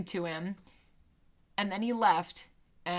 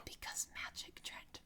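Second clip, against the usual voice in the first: hushed conspiratorial whisper; 20 dB quieter than usual. Real, not acted. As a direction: breathe it like a secret. Whispered aside.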